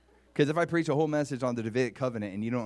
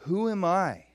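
higher, louder, emphatic question; amazed humility; 6 semitones higher, 5 dB louder, slower than usual. Acted. David asking the Lord, louder amazed wonder. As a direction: ask it with rising force and disbelief. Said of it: "This is his response".

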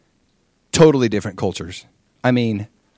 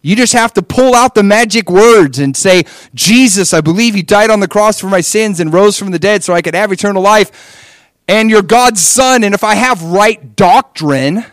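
higher, louder mock recital of a prayer formula; 7 semitones higher, 7 dB louder than usual. Preacher mocking his teenage self's formula prayer, loud and high. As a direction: parody it like empty magic words.

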